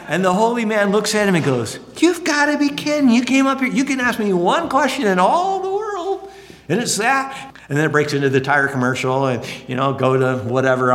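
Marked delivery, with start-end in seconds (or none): 2.0-7.5 high, exasperated mock disbelief; can't believe you wasted it, high and pushed; acted incredulous outburst. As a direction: play the holy man's disbelief, pitched way up. Holy man reacts to silly question.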